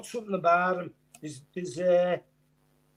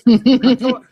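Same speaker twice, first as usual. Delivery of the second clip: excited; 2 semitones higher, 12 dB louder, much faster than usual. Real, not acted. Loud exclaim of praise.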